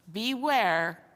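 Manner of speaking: ordinary delivery, nothing marked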